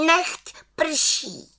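very high little witch casting a spell; witch calling out. A narrator's reading. Little witch commanding the cloud to rain, high call. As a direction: cry out the incantation in a high voice.

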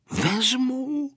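high, rising one-word assent; lightly voiced child saying yes; agreeing child. A narrator's reading. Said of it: "kýval hlavou Vojta".

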